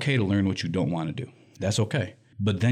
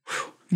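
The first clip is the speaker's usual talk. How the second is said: breathless exhale. Real, not acted. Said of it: "I'm running out of breath".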